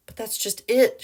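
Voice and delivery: high, loud distressed cry; cried out. A narrator's reading. Wife pleading to police, crying out. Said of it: "she cried".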